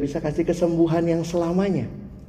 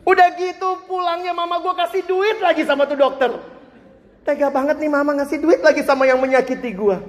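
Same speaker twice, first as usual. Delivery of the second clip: voicing the hurt child; 9 semitones higher than usual. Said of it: "Kesimpulan anak itu".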